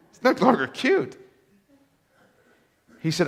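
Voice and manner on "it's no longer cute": repeated punchline. Real, not acted.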